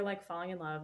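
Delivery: normal talking voice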